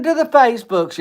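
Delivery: high, loud playful call; a high teasing peekaboo to the Facebooks; sing-song peekaboo. Pretend. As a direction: sing it out like popping up from behind a hill. Mock net-surfer calling out.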